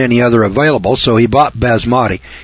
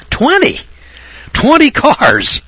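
"Twenty!" shot up much higher, then "Twenty cars!" slow; disbelief, as if the number were absurd; incredulous exclamation; 12 semitones higher, slower than usual. Real, not acted. Amazed at friend's twenty cars.

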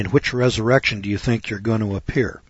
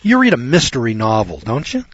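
normal talking voice

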